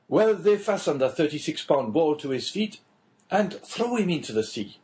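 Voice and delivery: a hint of the chatty official; lightly voiced prison inspector. Inspector telling the Englishman a prison tale, lightly voiced.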